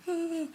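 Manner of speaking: high loud startled cry. Retold or imitated. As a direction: yelp in surprise. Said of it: "he's like".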